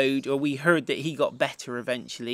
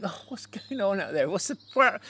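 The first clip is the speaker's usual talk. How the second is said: higher, mildly alarmed mock crowd; puzzled onlookers; 5 semitones higher than usual. People under falling plaster wonder what's going on, mock bewilderment. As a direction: play a bewildered crowd, lightly.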